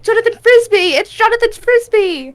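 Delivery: high, excited chanting of a crowd; hammed-up high exclamation; mock fan chorus. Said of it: "So everybody's like".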